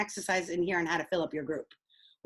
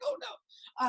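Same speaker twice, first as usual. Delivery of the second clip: mock dismay; 6 dB quieter than usual. Retelling her earthquake panic.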